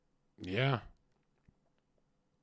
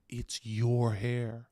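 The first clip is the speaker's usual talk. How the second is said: deliberate; much slower than usual. Retold or imitated. Slow deliberate retort.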